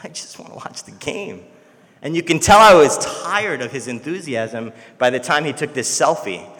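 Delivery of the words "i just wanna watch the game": weary plea. Retold or imitated. Son tired of his dad's enthusiasm, mildly weary. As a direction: sigh it out, fed up. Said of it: "And I'm like".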